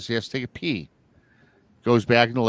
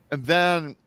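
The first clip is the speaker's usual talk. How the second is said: ordinary talk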